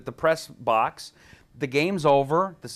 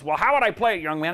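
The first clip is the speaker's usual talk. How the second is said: Rush voice; 5 semitones higher than usual. Retold or imitated.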